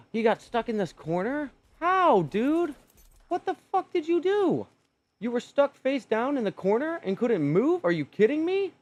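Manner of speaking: high, loud disbelieving yell; incredulous yelling. Real, not acted. Gamer yells at his dead dino, incredulous. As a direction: shout in outraged disbelief at the screen. Dino drowned in shallow water.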